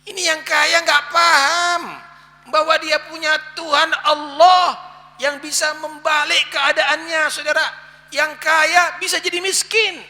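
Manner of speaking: high, loud preacher's cry; fiery preaching. Acted. Preacher warning the rich congregation, loud and high. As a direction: hammer it home to the congregation.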